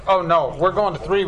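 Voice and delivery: normal talking voice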